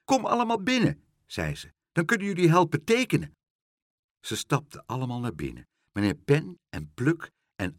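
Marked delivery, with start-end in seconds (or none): 0.0-1.0 high, quick welcome; happy child's high chirp; cheerful, beaming. A narrator's reading. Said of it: "heel vrolijk en stralend". 1.9-3.3 high, fast, eager invitation; gleeful and hurried; cheerful, beaming. A narrator's reading. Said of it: "heel vrolijk en stralend".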